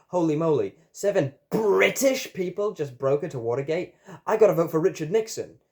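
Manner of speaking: high, loud, hammed-up amazement; astonished public reaction. A narrator's reading. People react to Watergate news, astonished. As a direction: exclaim like a flabbergasted member of the public.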